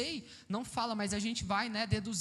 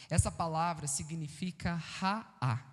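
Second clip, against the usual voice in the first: normal talking voice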